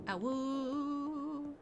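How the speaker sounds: wolf voice. Pretend. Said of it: "That's a sound that vampires make".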